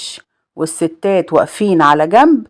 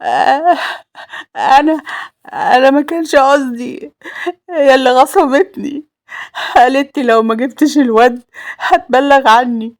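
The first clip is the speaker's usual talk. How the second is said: high, halting tearful confession; crying, broken voice; 6 semitones higher than usual. A narrator's reading. Roaya confessing to Salem that she was forced, crying brokenly. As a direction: sob between broken phrases. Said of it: "تعيط وتقول بصوت متقطع".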